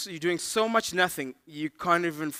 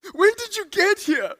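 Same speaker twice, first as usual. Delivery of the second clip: high, loud startled question; hammed-up astonishment; startled surprise; 10 dB louder than usual. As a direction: blurt it out as if someone just appeared. Stoner startled by the officer.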